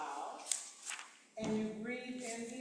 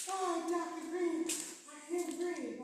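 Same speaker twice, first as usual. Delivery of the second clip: mock whiny student, higher and slow; imitating a student; 5 semitones higher, 4 dB louder, slower than usual. Retelling a student's complaint, in a mild whiny voice. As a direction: play a complaining young student, lightly. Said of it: "my young students say".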